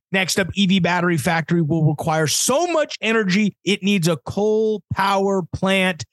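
ordinary delivery

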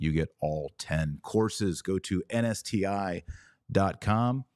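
normal talking voice

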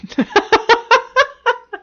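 a burst of high, loud laughter. Real, not acted. Laughs at own joke.

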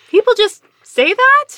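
high, loud, startled hairstylist voice; surprised hairstylist. Retold or imitated. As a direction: play the hairstylist, genuinely shocked. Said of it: "And she's like".

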